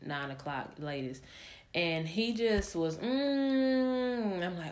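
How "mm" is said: put-on a short high hum, copying her toddler; imitated humming. Mom imitating her son humming in the meeting, high mock hum. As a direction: hum it like the restless little boy. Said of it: "like, humming thing he do".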